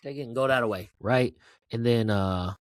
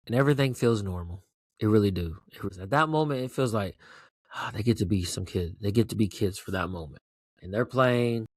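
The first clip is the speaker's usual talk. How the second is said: normal talking voice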